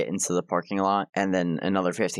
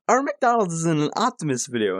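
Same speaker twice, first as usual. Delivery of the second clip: a touch of higher excited disbelief; freaking out; 6 semitones higher than usual. Retold or imitated. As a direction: lightly imitate his excitement. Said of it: "he starts freaking the fuck out".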